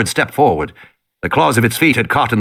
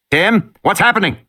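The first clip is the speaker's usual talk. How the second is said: worried man on radio; 8 semitones higher than usual. Acted. Man calls over radio.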